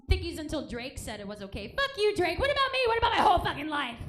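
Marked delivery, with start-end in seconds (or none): 1.8-4.0 high, loud, furious yell; angry rant. Real, not acted. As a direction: shout it out in outrage. Ranting at [name].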